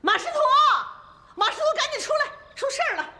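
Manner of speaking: high-pitched, urgent call across the house; alarmed hollering. Acted. Calling someone out because trouble happened, loud frantic fuss. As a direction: holler for help, making a great fuss. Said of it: "咋咋呼呼的".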